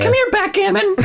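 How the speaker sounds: high, laughing pet call. Pretend. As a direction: sing out to a dog. Calling a pet by name.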